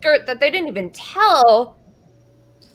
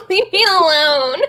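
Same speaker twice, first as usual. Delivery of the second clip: laughing whine; 6 semitones higher, 4 dB louder than usual. Real, not acted. Teased about her pronunciation.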